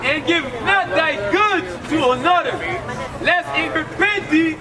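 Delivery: usual speaking voice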